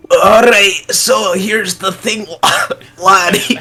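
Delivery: lightly attempted Scottish old-man voice. Player tries speaking dwarvish, rough Scottish old-man accent. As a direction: have a go at an aged Scottish dwarf. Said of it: "a Scottish accent in an old man voice".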